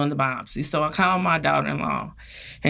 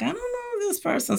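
high, soft dismissive mutter; retelling brushing off an unknown caller, high and dismissive; suspicious brush-off; 8 dB quieter than usual. Retold or imitated. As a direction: wave off a stranger's number with a sniff.

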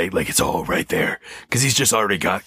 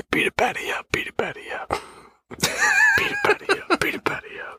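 rhythmic whispered chant; hushed, punchy parody of a song; faster than usual. Acted. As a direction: whisper it like a rap hook. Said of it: "It's the Whisper song".

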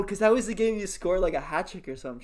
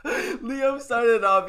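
laughing; 7 semitones higher, 7 dB louder, slower than usual. Real, not acted. Laughing while correcting story.

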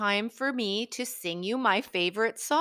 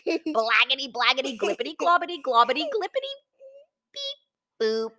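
loud, higher gibberish noises; silly sounds; 6 semitones higher, 5 dB louder than usual. Acted. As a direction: ham up nonsense noises. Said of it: "make silly sounds".

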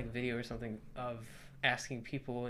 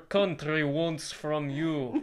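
slow, loud mock-Russian oration; hammed-up Russian version of a presidential speech; Russian accent; 3 semitones higher, 7 dB louder, much slower than usual. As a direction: declaim it in a thick Russian accent. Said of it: "That's the Russian version".

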